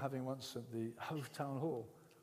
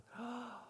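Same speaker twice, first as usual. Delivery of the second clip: a sharp high gasp of wonder; awed gasp; 9 semitones higher, 5 dB quieter than usual. Retold or imitated. Overwhelmed in prayer, he gasps in awe. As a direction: gasp as if God suddenly feels close.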